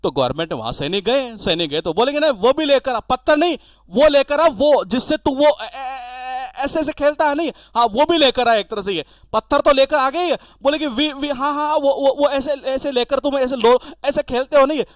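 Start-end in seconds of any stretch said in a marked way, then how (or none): 2.2-7.5 mock excited soldier. Soldiers mimic bat-swing gestures. 10.9-14.8 mock excited soldier. Soldiers mimic bat-swing gestures.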